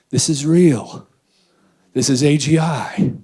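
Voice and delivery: loud mock conviction; mock awed claim. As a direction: announce it like a believer. Voicing people fooled by AI.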